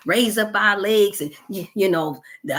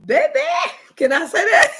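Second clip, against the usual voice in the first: high, loud, through a laugh; laughing excitedly; 9 semitones higher, 6 dB louder than usual. Real, not acted. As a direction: laugh it out, thrilled. Excited aside while laughing.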